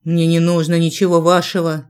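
usual speaking voice

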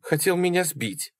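understated high sob; sobbing complaint. A narrator's reading. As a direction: whimper it as the wronged victim. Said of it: "всхлипывала Салли".

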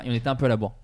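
ordinary delivery

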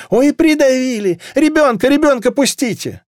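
high-pitched frantic wail; panicked cry. A narrator's reading. Woman crushed in the crowd with a child, panicked.

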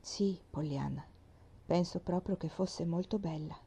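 barely audible, held back; very low voice. A narrator's reading. Doctor answering the girl quietly, soft and low. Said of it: "un tono della voce così basso".

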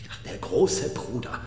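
whispered bitterness about the big brother; hushed and bitter. Acted. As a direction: let it slip out under your breath. Bitter confession about his brother.

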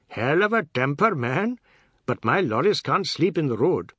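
high, loud, singsong character voice; brash Seth, jeering. A narrator's reading. Seth jeering at Biswas before the children, brash. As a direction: play a brash boss making light of a row.

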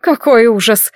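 ordinary delivery

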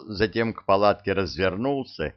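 ordinary delivery

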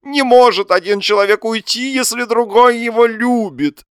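high, loud lovesick wail; stretched-out theatrical protest; exclaiming. A narrator's reading. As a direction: argue it in anguished melodrama. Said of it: "воскликнул Лоханкин".

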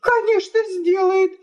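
very high, insistent wifely voice; scornful wife. Acted. Wife tells guest how husband begs, scornful. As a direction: predict it scornfully in a high pitch.

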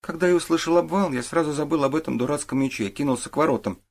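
lightly voiced boy giving his account; boy witness. A narrator's reading. Boy tells the detective what he did, earnest.

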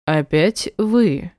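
slow, silky questioning; smoothly probing, teasing question; insinuating. A narrator's reading. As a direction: draw it out with a coaxing reproach. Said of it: "вкратчиво уточнил мужчина".

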